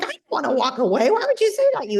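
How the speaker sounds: retelling an outraged reply to the husband; angry retort. Retold or imitated. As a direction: snap back at your husband in outrage. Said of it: "I had to get angry again".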